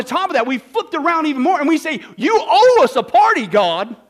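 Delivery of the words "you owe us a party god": high, loud mocking demand; louder sneering entitlement; entitled demand. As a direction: throw it at God like a spoiled child.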